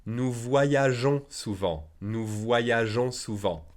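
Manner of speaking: slow, clear teacher model sentence; deliberate classroom pace; slow model reading. Shown as a demonstration. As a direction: say it slowly for students to repeat. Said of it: "teacher model sentence, slowed".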